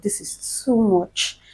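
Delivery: soft, slow, drawn-out complaint; hushed, slow lament; retold exhausted plea. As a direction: act worn out by pain, dragging the words quietly. Retold plea to nurses in labor.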